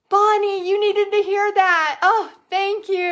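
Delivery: gasping, high and loud with a catch of tears; overwhelmed with real gratitude; choked-up, thrilled thank-you; touched excitement. Real, not acted. Moved by a viewer comment.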